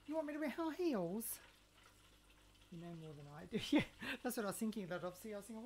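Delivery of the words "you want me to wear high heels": mock indignation.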